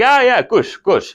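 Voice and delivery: high, loud awkward acknowledgement; imitated dad. Dad ending the sex talk, awkwardly relieved. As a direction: impersonate dad hastily backing out. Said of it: "He's like".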